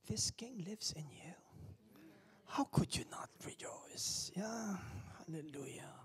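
soft whispered awe; hushed preaching. Real, not acted. Preacher whispering to the congregation. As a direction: whisper it with reverence.

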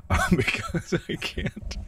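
words spoken through a breathy laugh; sheepish laugh mid-sentence; laughing while talking. Real, not acted. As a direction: laugh your way through it, slowly.

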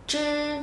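"chư" tròn miệng, lưỡi cong; "chư" không bật hơi, lưỡi cuộn; tiếng Trung zh. Shown as a demonstration. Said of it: "Đọc lại Z H nhá". ("chư" cong lưỡi không bật hơi)

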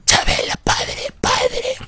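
high wailing cry at the priest; Chabela impression. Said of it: "Parece la Chabela". (imitando a la Chabela llorona)

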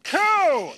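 high proud pigeon coo. Shown as a demonstration. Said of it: "Now that's a pigeon".

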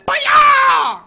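loud, high sudden war cry; torpedo wasp yell. Retold or imitated. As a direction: blast it out like a torpedo hitting.

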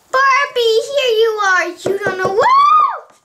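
high, loud cry to a friend; flustered exclaiming. Pretend. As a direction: burst out dramatically, pitched well up. Doll greets Barbie in a fluster.

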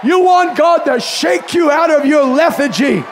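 shouted, high sermon climax; a preacher shouting at the congregation; full-force preaching. Acted. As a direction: preach it hard to the crowd.

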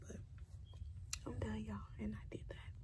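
late night whisper; quiet at night. Real, not acted. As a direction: keep it to a breathy whisper. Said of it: "so I'm trying to be quiet".

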